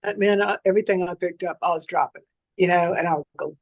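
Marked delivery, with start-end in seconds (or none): none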